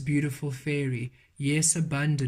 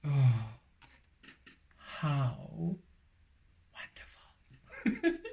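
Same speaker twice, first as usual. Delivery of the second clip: awed whisper; 3 dB quieter, much slower than usual. Real, not acted. Overwhelmed by the card reading.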